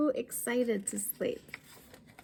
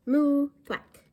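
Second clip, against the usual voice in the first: high loud moo; 6 semitones higher, 7 dB louder than usual. Acted. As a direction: moo thwack, higher and louder. Sound effects of busy farm.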